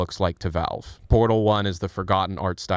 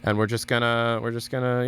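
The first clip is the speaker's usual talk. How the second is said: normal talking voice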